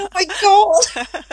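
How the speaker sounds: high, slow, drawn-out dismay; pupil's shocked inner thought. Retold or imitated. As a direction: stretch it out in disbelief. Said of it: "And I'm thinking".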